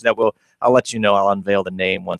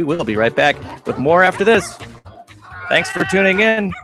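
radio outro; 7 semitones higher than usual. Acted. Station outro read.

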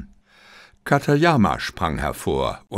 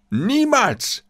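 much higher, shouted refusal; defiant cry; 12 semitones higher than usual. A narrator's reading. Katayama defiantly refuses to surrender. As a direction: hurl the refusal out. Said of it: "rief Katayama".

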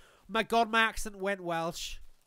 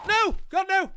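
high, loud cry of dismay; loud high-pitched protest; alarmed shout; 11 semitones higher, 10 dB louder than usual. Real, not acted. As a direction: shout in sudden gamer panic. Panicked shouting at the game.

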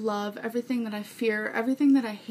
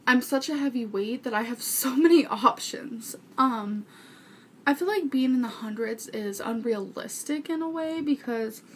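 ordinary talk

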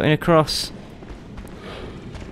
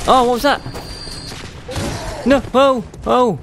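panicked; 10 semitones higher than usual. Real, not acted. Enemies swarm, panicked cries.

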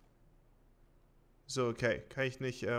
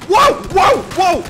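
high, loud startled scream; shocked scream; 21 dB louder, slower than usual. Real, not acted. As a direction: shriek in shock. Startled by sudden event.

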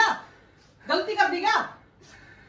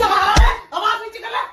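stern shout; 5 semitones higher, 6 dB louder, much slower than usual. Real, not acted. Scolding her to lower voice.

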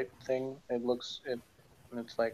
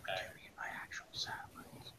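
hushed, much softer whisper; 5 semitones lower, 16 dB quieter than usual. Real, not acted. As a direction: breathe the question almost silently. Question asked under breath.

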